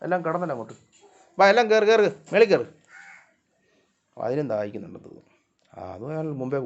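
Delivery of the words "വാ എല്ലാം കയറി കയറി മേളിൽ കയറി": loud, raised call to the cats. Real, not acted. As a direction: round them all up, voice lifted. Calling all the cats up.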